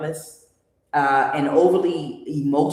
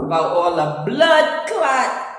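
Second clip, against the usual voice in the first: mock Jamaican accent; 5 semitones higher, 5 dB louder, much slower than usual. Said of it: "doing a horrible accent".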